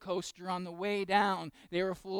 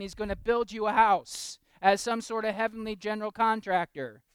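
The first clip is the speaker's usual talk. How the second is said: louder, slow preacher's build; preaching at full force; 6 semitones higher, 10 dB louder than usual. Acted. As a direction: proclaim it to the back pews. Preaching about heaven.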